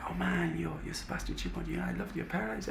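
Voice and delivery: high, soft voice of an awed fan; starstruck stranger. Retold or imitated. Stranger coming up in the street to the busker, starstruck.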